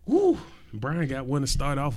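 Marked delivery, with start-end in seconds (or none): 0.1-0.4 high whooping cheer; hype shout. Real, not acted. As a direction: let out a loud woo. Hype shout starting rants.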